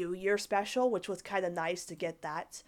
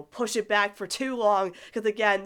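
ordinary talk, nothing marked

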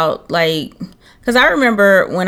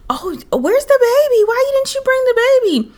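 high, fake-sweet prying voice; mock nosy people. Mimicking people asking where the baby is, high fake-sweet. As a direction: imitate the busybodies up high. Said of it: "I still get that reaction".

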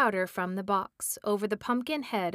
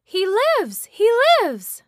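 high, loud, witchy shriek of triumph; ecstatic scream; 12 semitones higher, 12 dB louder, slower than usual. Acted. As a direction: scream it out in wild delight. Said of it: "she screamed".

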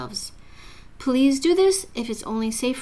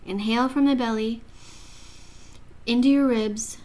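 guided meditation; 3 dB quieter than usual. Acted. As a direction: ease the listener into calm breathing.

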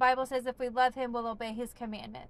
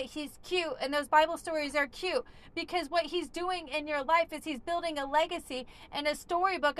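loud, high, driving sermon voice; full-force preaching; 6 semitones higher, 4 dB louder than usual. Acted. Preacher rallying people out of addiction, loud and high. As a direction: preach it out with fire to the room.

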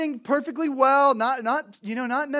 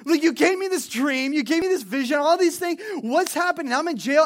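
exasperated complaint; 7 semitones higher, 8 dB louder than usual. Acted. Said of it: "At one point, you're like".

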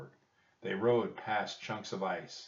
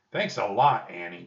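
plain reading voice

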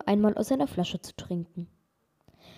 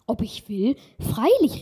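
joyful exclaim; 3 semitones higher, 6 dB louder than usual. A narrator's reading. Hans gladly accepts the grinder's offer, loud joyful exclaim. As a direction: shout out a happy yes. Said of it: "rief Hans ganz erfreut".

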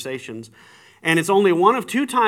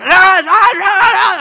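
much higher, very loud, slow wordless shouting; drawn-out mock yelling of an angry drunk; imitated freaking out; 15 dB louder, much slower than usual. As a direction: bellow each ah like a man losing it. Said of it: "he's just freaking out".

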